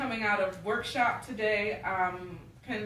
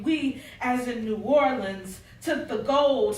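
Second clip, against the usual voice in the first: louder, rhythmic poetry performance; spoken-word poem; 3 semitones higher, 6 dB louder than usual. Acted. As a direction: perform it as a spoken-word welcome to your city. Recites her poem to audience.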